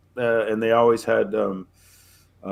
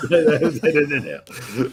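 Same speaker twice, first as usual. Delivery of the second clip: laughing, slowed; 7 semitones higher, 4 dB louder, much slower than usual. Real, not acted. Laughing at the veteran story.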